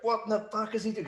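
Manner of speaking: high, strained imitation of an exasperated boss; high-pitched mock disbelief. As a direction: mimic the boss losing patience. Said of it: "They were just like".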